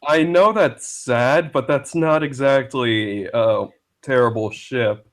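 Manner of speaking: ordinary talk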